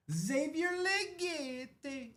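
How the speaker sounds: "Xavier Legette" sung out high and very slowly, every syllable held; stretched-out name call. Shown as a demonstration. Card breaker announcing a pull to chat, stretched high and slow. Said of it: "That's how I say it".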